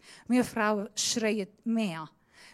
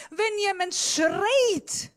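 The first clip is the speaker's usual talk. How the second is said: higher, slow shout; preacher shouts to show how yelling feels; 9 semitones higher, 3 dB louder, much slower than usual. Shown as a demonstration. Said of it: "wie ich jetzt mache".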